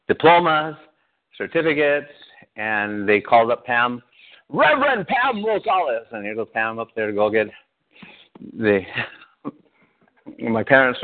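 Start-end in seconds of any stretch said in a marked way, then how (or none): 4.5-6.1 higher, slow ceremonial name call; announcer calling name. Retold or imitated. Announcer calls Pam to get diploma, ceremonial call. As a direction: call her up like at graduation.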